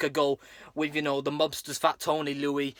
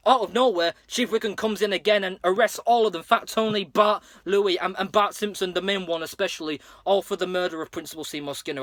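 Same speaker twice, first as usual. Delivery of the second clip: normal talking voice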